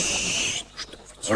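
a hurried shushing whisper, barely voiced; urgent whisper so no one nearby hears; hushed whisper. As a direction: whisper sharply to hush him. Hushing him during the concert.

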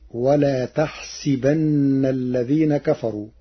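تحسبن with ta and kasra on the sin; Warsh reading. Shown as a demonstration. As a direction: say تحسبن as taḥsiben. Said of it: "فتصير".

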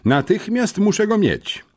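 high, pressing demand from the head; demanding headmistress. Acted. Headmistress demanding the missing boy, high and pressing. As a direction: insist he is brought at once.

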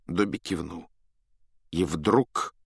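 plain reading voice

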